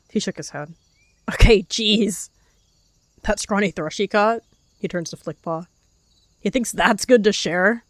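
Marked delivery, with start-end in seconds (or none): 1.3-7.8 scornful mockery. A narrator's reading. Warrior mocks gift, gossiping.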